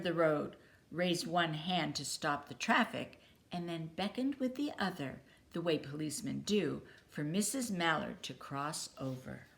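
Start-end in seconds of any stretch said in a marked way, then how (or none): none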